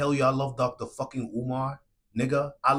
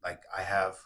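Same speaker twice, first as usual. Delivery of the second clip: sincere from the soul; 4 semitones lower than usual. Real, not acted. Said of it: "because I'm talking from my soul".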